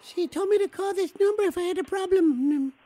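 a grown man playing a lost little kid. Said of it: "you didn't sound like a little kid".

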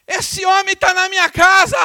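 high, loud excited yell; a startled woman shouting the news. Retold or imitated. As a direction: shout it to the whole house in disbelief. Woman shouting about pastor at gate.

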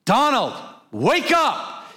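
insistent high-pitched dad yell; father's wake-up voice. Retold or imitated. Father trying a second time with his unresponsive son, insistent shout. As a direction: call out sharply to a son who won't get up. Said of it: "I'm gonna use my father's voice".

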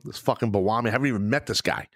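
ordinary talk, nothing marked

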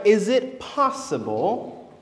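ordinary delivery

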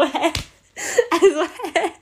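high, loud "huh?"; hammed-up bewilderment with a laugh; utterly baffled. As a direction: blurt out total incomprehension. Baffled by doctor's logic.

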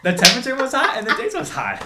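loud, high, silly sing-song; goofy emphasis. Real, not acted. Reviewer hamming up the hot sandwich, loud and high. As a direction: overplay it, half laughing. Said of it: "I don't know why I said it like that".

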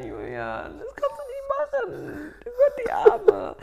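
slow whisper, feigned pity; whispered mock pity. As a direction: whisper it in fake sympathy.

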